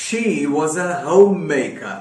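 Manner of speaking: each word laid out slowly for learners; slow teacher's example sentence; slow dictation. Shown as a demonstration. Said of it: "या फिर".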